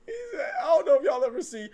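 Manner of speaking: can barely get the words out for laughing; helpless laughter. Real, not acted. Laughing while retelling a movie scene, high and loud. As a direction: voice squeaking high through laughter. Cracking up mid-story.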